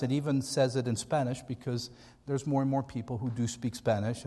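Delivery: ordinary talk, nothing marked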